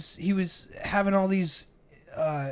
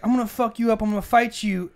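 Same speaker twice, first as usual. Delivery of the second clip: louder, higher imitated threats; retold threat; 6 semitones higher, 5 dB louder than usual. Small aggressor threatens a bigger man, mock angry threat. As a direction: act out a hothead spoiling for a fight.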